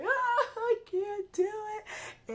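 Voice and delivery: high, loud, slow wail; crying out. Retold or imitated. Breaking down crying to her advisor, a high slow wail.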